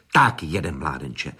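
low, restrained voice of command; understated commanding tone, dropping at the end. A narrator's reading. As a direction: give a low-pitched order, mildly authoritative. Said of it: "řekl mi velitelským hlasem".

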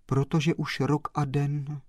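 ordinary delivery

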